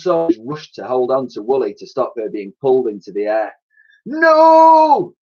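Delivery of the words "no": very high, loud scream. Acted. Lady Buckshot as Zeppelin crashes, scream. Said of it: "screamed Lady Buckshot".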